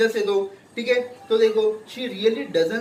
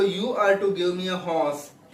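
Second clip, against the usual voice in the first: slow read-aloud; much slower than usual. Acted. Girl demands a horse.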